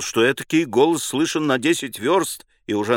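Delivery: plain reading voice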